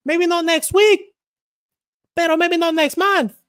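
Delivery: put-on very high, loud Mexican-accented boast; Brandon Moreno. Fighter vowing to become champion, high Mexican accent. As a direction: proclaim the future title like a Mexican fighter. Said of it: "That's what Brandon Moreno said".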